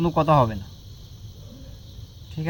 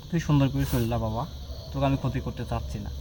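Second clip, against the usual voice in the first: soft, low, kindly urging it to leave; gentle coaxing; 6 semitones lower, 9 dB quieter than usual. Real, not acted. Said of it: "তোকে আমি সুন্দর করে বলতেছি". (নরম গলায় আদর করে বোঝানো)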